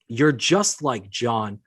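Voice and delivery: drawn-out, deliberate readback of an accusation; slow mocking readback. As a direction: quote it very slowly, word by word. Reading her accusation back, offended.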